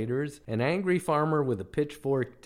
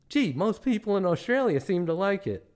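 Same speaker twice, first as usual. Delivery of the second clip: higher-pitched naive protest; meek tourist; 7 semitones higher than usual. Acted. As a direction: object politely, pitched up. Himself meekly answering the rude guide.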